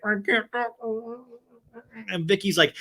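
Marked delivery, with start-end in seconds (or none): 0.0-0.8 high, muffled mouthing; miming lips. Retold or imitated. Cast member silently hinting she has a secret, mouthed. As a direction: squeeze it out as if barely allowed to speak. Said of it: "still miming the lips like".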